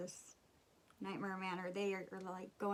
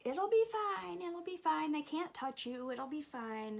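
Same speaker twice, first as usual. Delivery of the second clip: friends reassuring; 7 semitones higher than usual. Retold or imitated. Friends teasing the scared girl in line, mock breezy. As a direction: brush off her fear lightly, like friends would. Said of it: "And they're like".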